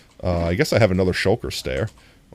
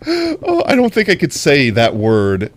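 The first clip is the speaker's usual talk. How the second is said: normal talking voice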